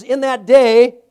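"In that day" loud and high, stress on "day"; emphatic quote. Acted. Preacher pressing the phrase "In that day" on the congregation. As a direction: hit "In that day" hard.

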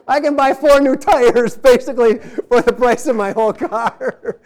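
words breaking into laughter; chuckling at his own cheap car; laughing. Real, not acted. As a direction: laugh through the line.

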